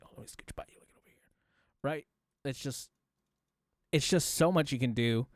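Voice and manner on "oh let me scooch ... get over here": very soft, fading aside; hushed voice trailing off out of range; moving off-side. Shown as a demonstration. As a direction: drop away as if slipping past someone. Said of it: "I'm gonna walk over here".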